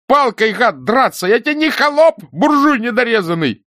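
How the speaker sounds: very high, loud, offended yelling; thin, aggrieved shout. A narrator's reading. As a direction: shriek your grievance in a thin voice. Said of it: "закричал тонким, обиженным голосом". (тонкий обиженный крик)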